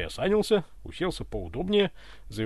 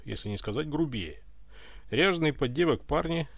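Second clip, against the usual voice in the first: usual speaking voice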